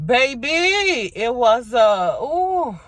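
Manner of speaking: high, loud, drawn-out reaction; emphatic exclamation. Real, not acted. Vlogger reacting to her own past, emphatic exclamation. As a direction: let it burst out high, then an ooh. Reacting to hard times living with parents.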